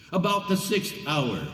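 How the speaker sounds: usual speaking voice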